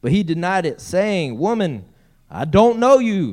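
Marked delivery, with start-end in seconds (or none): 1.4-3.3 put-on loud, raised denial with a touch of Albemarle accent. Peter denying Jesus to the servant girl, slight Albemarle accent. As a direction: deny it emphatically, lightly Albemarle. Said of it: "Sounds like he's from Albemarle".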